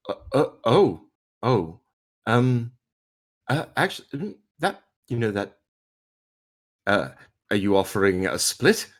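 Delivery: halting, stammered question with long gaps; flustered hesitation. Acted. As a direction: fumble for words before daring to ask. Flustered Junior fumbles a question.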